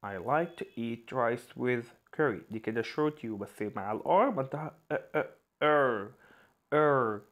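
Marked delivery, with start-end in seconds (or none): 0.0-2.4 very slow, each word apart; very slow dictation. Shown as a demonstration. Teacher reads an example sentence very slowly. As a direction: spell out the sentence slowly for learners. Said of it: "slow example sentence".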